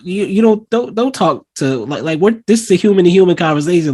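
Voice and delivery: stumbling, indignant mock reprimand; acted scolding. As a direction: imitate an exasperated adult correcting a child. Voices aunt telling doll off.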